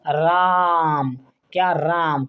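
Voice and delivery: राम held long and slow, three beats; long drawn राम. Shown as a demonstration. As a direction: stretch राम out slowly. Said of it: "लंबा समय लग रहा है".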